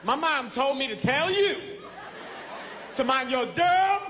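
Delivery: slow, overdone rehearsal of the line; over-the-top mirror rehearsal; too loud rehearsal. Pretend. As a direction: practice it too forcefully in the mirror. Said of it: "that's too loud".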